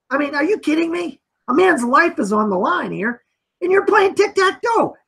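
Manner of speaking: high-pitched, acted indignation; mock outrage, pitch leaping up in disbelief; upset juror. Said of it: "people are pretty upset about that".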